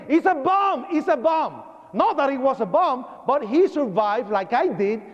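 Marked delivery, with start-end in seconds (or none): 0.1-1.6 raised-pitch cry of alarm; imitated screaming. Supervisor who survived an earlier bombing, imitated alarmed scream. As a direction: re-enact an alarmed scream, no real shouting. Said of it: "is screaming".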